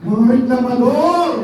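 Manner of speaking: exclaiming. Acted. Preacher scolding complainers.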